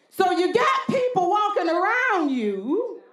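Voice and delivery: rising, fervent preacher's cadence; preaching. Acted. Preacher stirring the congregation to a fervent peak. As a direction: preach it out with building fire.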